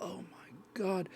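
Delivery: hushed, breathy "Oh my God"; awed whisper. Retold or imitated. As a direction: whisper it in slow dread. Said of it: "He's like".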